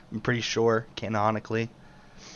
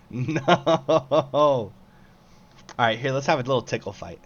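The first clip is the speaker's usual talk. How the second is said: ordinary talk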